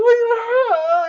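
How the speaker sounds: high cackling laugh. Real, not acted. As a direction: burst out laughing. Laugh at his parody.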